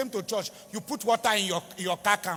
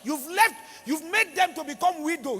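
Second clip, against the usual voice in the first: emphatic shout; 6 semitones higher, 3 dB louder than usual. Real, not acted. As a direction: lean hard on each word. Pastor mourning slain policemen.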